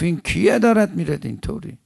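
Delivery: high, loud, marvelling question; acted astonishment. Malik asks who that fighter is, loud astonishment. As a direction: cry the question out, pitch soaring in wonder.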